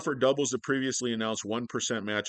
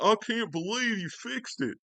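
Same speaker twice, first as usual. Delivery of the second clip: high, drawn-out mock astonishment; hammed-up astonished customer voice; amazed customer; 14 semitones higher, 4 dB louder than usual. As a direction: gush in exaggerated disbelief.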